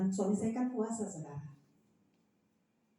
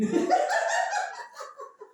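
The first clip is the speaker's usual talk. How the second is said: loud high burst of laughter; big high-pitched laugh; real laughter; 6 dB louder than usual. Real, not acted. Laughs at own story.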